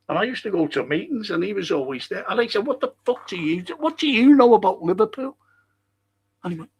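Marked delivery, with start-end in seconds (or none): 2.7-5.4 much higher, fast angry demand; fuming challenge. Retold or imitated. Confronting the Scottish fella, fuming. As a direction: tear into him. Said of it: "Because I was fuming".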